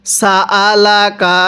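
সা আলাকা said slowly with short even vowels; no stretch. Shown as a demonstration. Teacher shows short vowels, slow recitation. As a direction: keep সা আলাকা unstretched and slow. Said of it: "কোনো প্রকার টান দিব না".

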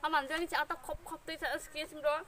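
words slurred by food; speaking between bites; talking while chewing. Real, not acted. Seen 1: Took a bite just before, jaw works while talking, food in hand.

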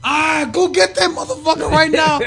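high, loud, slow enraged shout; furious shout. Retold or imitated. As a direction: bellow the order in fury. Sandman slamming car door.

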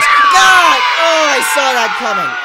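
scared yell. Real, not acted. Jump scare, loud yell.

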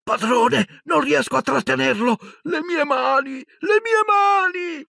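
very high, crying screams of pain; screaming in agony. Acted. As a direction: wail as your hands burn. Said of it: "torcersi urlando in agonia".

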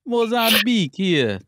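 a hint of a Caribbean game character; imitating a Caribbean game character. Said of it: "she's like".

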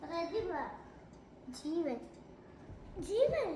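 hushed, low command after being asked to ease off; taking it gently. Real, not acted. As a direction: say each command softly and low. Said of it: "പതുക്കെ, പതുക്കെ പറ".

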